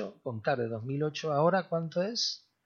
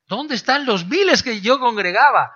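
much higher, louder hammed-up lament; mock wail; 11 semitones higher, 11 dB louder than usual. Preacher mocks a weeping pastor asking where his thousands went. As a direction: wail it like a pastor crying over lost crowds. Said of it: "Un pastor lloraba y decía".

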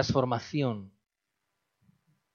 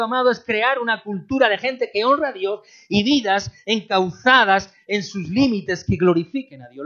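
loud, high preaching; loud impassioned sermon; fiery preaching; 9 semitones higher, 11 dB louder than usual. Acted. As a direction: preach it hard to the congregation. Preaching at full force.

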